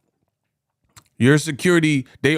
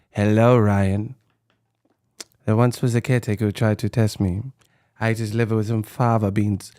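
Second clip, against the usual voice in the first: Hannibal Lecter; 6 semitones lower, 4 dB quieter than usual. Retold or imitated. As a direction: savor each word like a calm cannibal. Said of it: "Hannibal Lecter".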